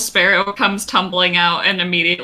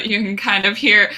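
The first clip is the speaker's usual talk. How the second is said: ordinary talk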